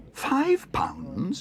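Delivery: very high squeak of disbelief; shocked exclamation. A narrator's reading. Young bear shocked at the price, exclaiming. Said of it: "exclaimed Paddington".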